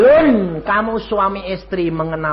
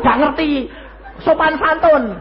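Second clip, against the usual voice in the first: ordinary delivery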